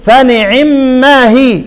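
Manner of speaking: very slow فنعم ما هي with the kasra of العين snatched; reciting with ikhtilas. Shown as a demonstration. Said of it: "ونيجي نقول إيه؟". (فنعم ما هي باختلاس كسرة العين تلاوة بطيئة)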